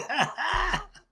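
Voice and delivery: high burst of real laughter; high, helpless cackle of amusement; big laugh. Real, not acted. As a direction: burst out laughing, pitch shooting up. Cracks up at his own story.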